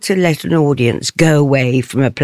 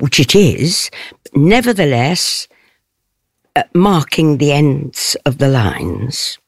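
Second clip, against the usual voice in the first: normal talking voice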